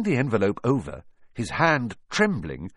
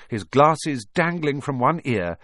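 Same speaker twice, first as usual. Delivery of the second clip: ordinary delivery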